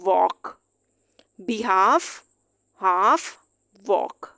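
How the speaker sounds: वॉक behalf हाफ वॉक, l dropped; silent l words. Shown as a demonstration. Teacher drills the silent l words. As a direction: drill each word with no l sound. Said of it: "एल यहाँ पे भी silent".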